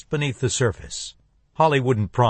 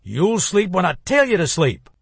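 sneering; 7 semitones higher than usual. Acted. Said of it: "The elder Laney sneered".

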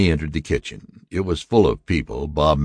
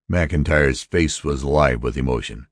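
usual speaking voice

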